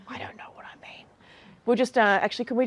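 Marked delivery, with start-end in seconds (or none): 0.1-1.1 barely audible whisper under the breath; mock-confused whisper, almost lost; whispered aside. Hushed comic aside to herself.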